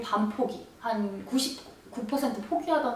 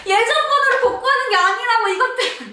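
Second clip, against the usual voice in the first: much higher, louder exasperated cry; exasperated yell; 12 semitones higher, 7 dB louder than usual. Retold or imitated. Yelling at the store clerks, exasperated. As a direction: cry out that they have it wrong.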